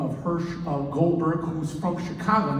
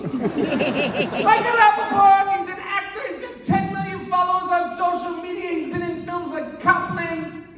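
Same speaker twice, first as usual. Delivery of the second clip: much higher, sing-song bragging list; eager captor pitch; 12 semitones higher, slower than usual. Acted. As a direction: sell the hostage like a hyped announcer. Captor lists his credits.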